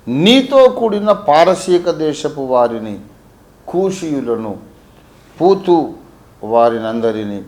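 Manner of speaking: loud, high, measured verse reading; ringing public Bible recitation; scripture reading. Acted. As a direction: proclaim the verse phrase by phrase. Reads the Bible verse aloud.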